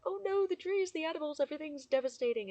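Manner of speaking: high, fast mock alarm; mock panic. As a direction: parody people fretting about the trees. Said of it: "everyone's all like".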